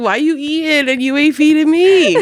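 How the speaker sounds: indignant cat, high and loud; playful, laughing holler of outrage; mock yelling cat. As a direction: complain loudly through laughter. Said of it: "he's, like, yelling at her".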